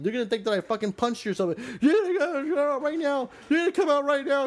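mock frantic pleading. Boyfriend pleading with girlfriend in the car, mock frantic. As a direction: plead with her, pitch up and rushing. Him trying to calm her.

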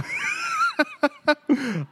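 high, loud squealing laugh; laughing hard. Real, not acted. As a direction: cackle in a high pitch. Cracks up again.

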